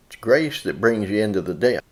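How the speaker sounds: usual speaking voice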